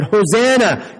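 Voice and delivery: a bright cry of praise; excited Hosanna. A narrator's reading. Crowd hailing Jesus, calling out excitedly. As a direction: call it out like the crowd. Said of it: "and they said".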